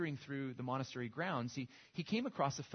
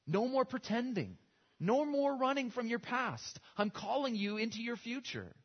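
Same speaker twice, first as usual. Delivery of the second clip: preaching God's call; 7 semitones higher, 5 dB louder than usual. Acted. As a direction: preach it like God calling Moses out of hiding. Voices God calling Moses.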